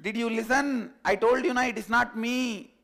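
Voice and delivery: loud high reply; the sheep shouts back from afar, loud and high; calling back. Acted.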